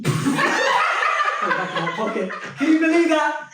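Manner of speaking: high, loud laughing disbelief; laughing at himself. Real, not acted. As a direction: laugh it out at your own foolishness. Laughing at own naivety.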